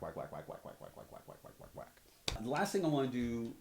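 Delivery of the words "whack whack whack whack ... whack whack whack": whacks fading down to a whisper; mock drum hits fading out. Shown as a demonstration. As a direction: imitate dull hits, dying away. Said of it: "rather than whack".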